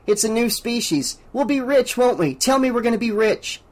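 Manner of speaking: put-on young Pete, bubbling over about getting rich; excited kid. A narrator's reading. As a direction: pitch it up like a boy dreaming of riches.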